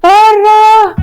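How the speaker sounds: high, loud furious shout. Acted. The girl, throwing paper at him, shouts at him to get out, loud. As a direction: throw him out with one yell. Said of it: "تحدفها عليه".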